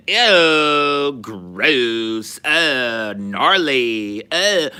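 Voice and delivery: put-on high, loud Valley Girl squeals of disgust; mock Valley Girl. Mocking high school Valley Girls for the co-host, squealing Valley Girl. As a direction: overdo a grossed-out Valley Girl. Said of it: "But they were like".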